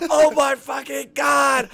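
high, loud, drawn-out disbelief; stunned, slow-motion shout; shocked exclamation. Retold or imitated. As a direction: cry out as if your mind is blown. His stunned reaction to the shouting.

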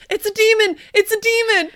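high, loud panicked cry; panicked shriek. Acted. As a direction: scream it like you saw a demon. Pizzeria owner panics at water.

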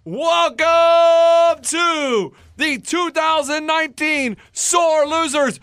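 booming slow ringmaster announcement. Acted. Grand opening of draft special.

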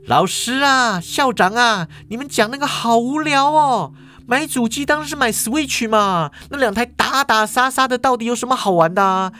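put-on high-pitched bratty young girl voice; girl barging in, scoffing at the men's console debate; Ye Feng. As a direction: whine it up high like a spoiled kid. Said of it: "叶枫突然就走进来说".

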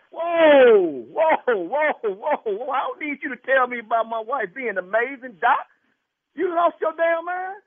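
loud, high whoa, then heated protest; real indignation. Real, not acted. Husband stopping doctor praising his wife, outraged.

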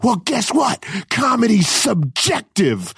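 high-pitched, drawn-out retort; retold gotcha, slowing on the punchline; defiant comeback. Comedian outwitting the security guard, defiant. Triumphant comeback in acted row.

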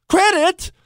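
pitched high, disbelieving repeat; incredulous. Real, not acted. Host scoffs at Trump wanting vaccine credit, disbelieving. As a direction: toss the word back in disbelief.

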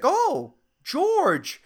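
high-pitched lilting imitation; creepy customer voice. Customer learning barista's name, high lilting mimicry. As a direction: say it high and drawn in his voice. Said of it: "like, embarrassed".

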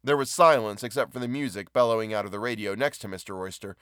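ordinary delivery

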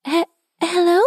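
high, hesitant stammered greeting; stammering. A narrator's reading. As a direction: stutter nervously into a rising question. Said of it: "she stammered".

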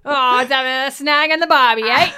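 very loud, high broad Australian caricature; exaggerated Aussie. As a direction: belt it out as a cartoon Aussie. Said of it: "the most Australian man on earth".

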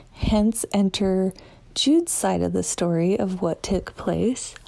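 ordinary talk

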